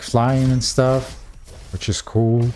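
ordinary delivery

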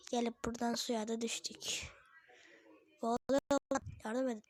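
hushed mock distress; whispering. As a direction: whisper a pretend plea for help. Fell in water, mock drowning.

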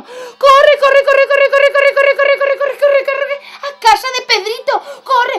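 high, loud, rapid-fire panic; frantic urging. Acted. Piglets flee to Pedrito's house, frantic high shouting. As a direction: squeal "run" over and over, urgently.

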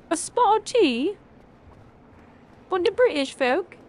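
sip-your-tea posh English voice; posh British parody. Pretend. Streamer teasing British chat in a mock-posh British accent. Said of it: "I'm British too".

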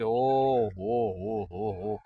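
put-on slow, drawn-out drunk's exclamation; drunken drawl. Drunk cyclist peers into grave, slow drunken ooh. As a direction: stretch it out like a tipsy man.

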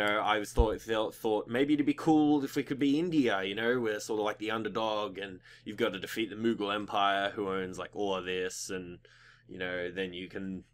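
usual speaking voice

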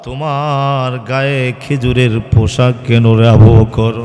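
usual speaking voice

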